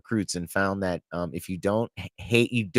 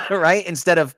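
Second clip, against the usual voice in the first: ordinary delivery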